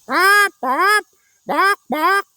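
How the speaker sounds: high, loud parrot bops; squawking parrot cries. A narrator's reading. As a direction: squawk while flapping after them. Parrot chasing them.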